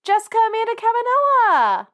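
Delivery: high, theatrical name call; put-on throwback voice. Host performing a shout out stolen from co-host, high put-on voice. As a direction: pitch the full name way up.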